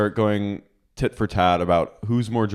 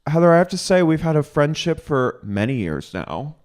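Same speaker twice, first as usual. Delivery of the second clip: mock formal reproach; 7 semitones higher than usual. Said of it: "she goes".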